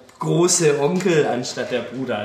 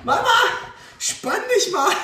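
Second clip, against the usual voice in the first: put-on high child voice calling. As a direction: shout it like a small child. Little sister calls across store.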